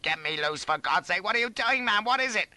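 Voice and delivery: high pleading building to panic; rising high-pitched alarm; frantic cry. A narrator's reading. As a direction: demand help desperately. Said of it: "I cried".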